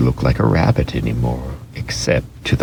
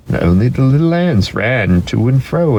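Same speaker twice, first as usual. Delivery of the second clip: ordinary delivery, nothing marked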